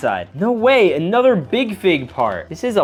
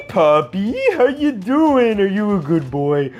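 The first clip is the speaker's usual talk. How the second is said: doting owner cooing at a puppy; puppy talk. Acted. Talking to the dog figure.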